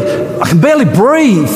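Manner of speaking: rising sermon fervour; preaching at full force. Acted. Preaching build-up.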